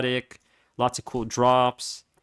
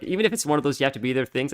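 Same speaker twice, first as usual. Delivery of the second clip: ordinary talk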